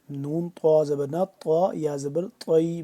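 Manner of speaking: ordinary talk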